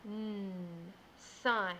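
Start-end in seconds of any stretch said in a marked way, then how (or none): 0.1-0.5 n hummed as a lone sound; isolated N sound. Shown as a demonstration. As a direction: hum the n sound alone. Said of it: "tap out all the sounds I hear".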